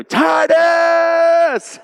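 high, loud yell of the son's name. Retold or imitated. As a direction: call out sharply for the kid. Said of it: "You go".

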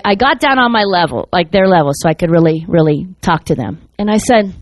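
usual speaking voice

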